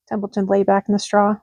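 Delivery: plain reading voice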